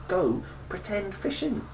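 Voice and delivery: ordinary delivery, nothing marked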